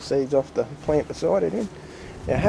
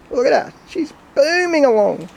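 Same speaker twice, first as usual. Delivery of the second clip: amazed; 11 semitones higher, 5 dB louder than usual. Real, not acted. Amazed at the thriving mint.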